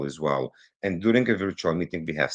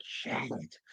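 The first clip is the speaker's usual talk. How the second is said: whispered curse; 13 dB quieter than usual. Retold or imitated. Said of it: "It's like".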